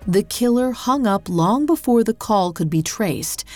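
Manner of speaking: usual speaking voice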